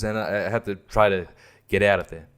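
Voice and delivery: mock lifeless line reading; drained, unacted delivery, all anguish gone; stopped acting. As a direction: imitate an actor suddenly dropping the performance. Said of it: "all of a sudden he stops. He just stops acting".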